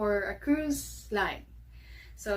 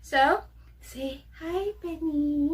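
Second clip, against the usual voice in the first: higher, slow coaxing pet voice; 6 semitones higher, slower than usual. Real, not acted. Owner coaxing her dog Benny to greet listeners, higher baby talk. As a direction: coax the puppy to say hi.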